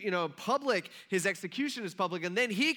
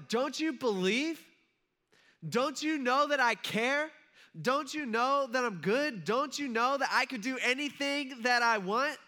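higher, slow, drawn-out rhetorical questions; earnest pleading challenge; 6 semitones higher, slower than usual. Acted. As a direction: press each question home, voice lifted. Jesus challenging Martha in retelling.

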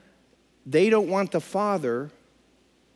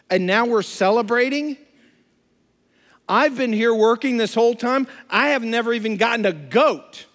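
paraphrased grievance, mildly heated and higher; mad older brother; 5 semitones higher than usual. Retold or imitated. Older brother complains he never got a party, mildly indignant. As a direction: push the resentment without shouting. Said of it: "He's mad, he's angry".

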